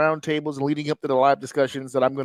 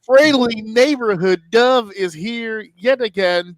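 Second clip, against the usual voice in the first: slow, louder ring announcer intro; hype introduction; 5 semitones higher, 5 dB louder, slower than usual. Acted. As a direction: drag it out like an announcer.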